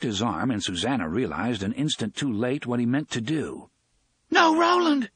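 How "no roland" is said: loud, very high cry of horror; sudden high horrified shout. A narrator's reading. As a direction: cry out in alarm to stop him. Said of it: "her own horrified voice".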